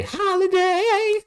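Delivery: high, slow sing-song call of the name; drawn-out call. Pretend. Streamer calling out Justin Holiday's name, high drawn-out call.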